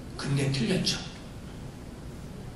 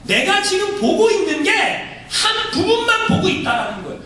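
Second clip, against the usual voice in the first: ordinary talk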